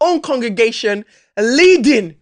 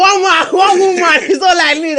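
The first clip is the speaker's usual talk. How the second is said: laughing; 10 semitones higher, 7 dB louder than usual. Real, not acted. Mock-proud boast, laughing.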